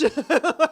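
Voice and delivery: high, loud laughing burst; cracking up for real; loud laughter. Real, not acted. Laughing hard at the scene.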